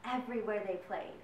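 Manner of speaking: usual speaking voice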